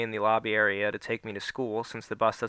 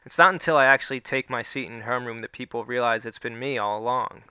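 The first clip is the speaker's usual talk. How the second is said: ordinary delivery, nothing marked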